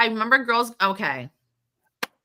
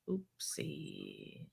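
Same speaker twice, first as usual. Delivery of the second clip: whispered oopsie; 6 semitones lower, 20 dB quieter than usual. Retold or imitated. Retold party mishap quote.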